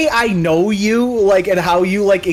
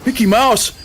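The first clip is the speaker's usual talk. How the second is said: bad impression; 5 semitones higher than usual. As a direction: play a laid-back goofy dude. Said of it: "You'd be like".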